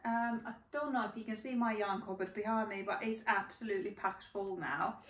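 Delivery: ordinary talk, nothing marked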